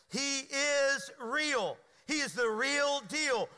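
very slow, every word pressed, "is" and "He" hit hard; emphatic preaching. Real, not acted. Preacher insists to the congregation that God is real. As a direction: drive it home word by word.

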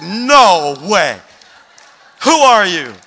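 very loud, astonished outburst; re-enacted shock, punched loud; stunned disbelief. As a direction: boom it out in total disbelief. Pastor stunned at being recognised.

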